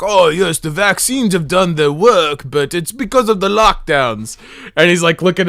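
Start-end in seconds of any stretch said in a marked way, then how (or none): 0.0-4.4 slow, put-on British politician; mock British accent. Politician telling someone about lockdowns, in a mock British accent. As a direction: parody him in a hammy British accent. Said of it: "he's telling this person, he's like".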